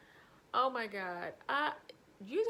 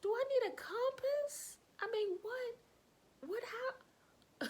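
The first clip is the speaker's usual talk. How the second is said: much higher, squeaky disbelief; baffled; 13 semitones higher, slower than usual. Retold or imitated. Baffled stylist reacting to the client's compass directions, high and squeaky. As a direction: squeak it out in total confusion. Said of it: "I was like".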